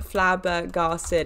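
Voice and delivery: FLABBERGASTED said very slowly, part by part; sounded out slowly. Shown as a demonstration. Wondering about the word's origin, flabbergasted sounded out slowly. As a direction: draw out each syllable of flabbergasted. Sounding out a word she likes.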